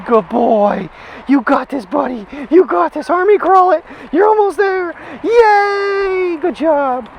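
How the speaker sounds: high, loud praising pet voice. Real, not acted. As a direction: cheer him through to the end. Coaxing dog through.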